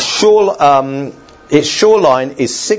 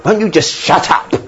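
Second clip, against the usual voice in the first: exasperated parent; 6 semitones higher than usual. Retold or imitated. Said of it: "we just say".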